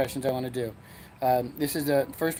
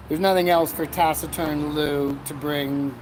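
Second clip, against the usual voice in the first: ordinary talk, nothing marked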